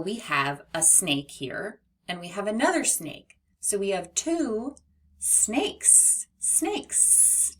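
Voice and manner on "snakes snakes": snakes with a long hissing S at the end; drawn-out sssnakes for the class; stretched final S. Shown as a demonstration. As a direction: hold the S of snakes, rising high. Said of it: "Do you hear the S at the end of snakes?".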